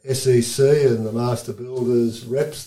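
usual speaking voice

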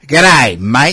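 loud, punchy Aussie greeting; broad Australian accent. Shown as a demonstration. Host shows guest everyday Australian talk, broad Aussie accent. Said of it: "I'm used to the Australian accent".

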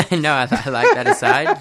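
laughing. Real, not acted. Laughs at host's aside.

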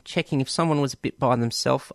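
ordinary talk

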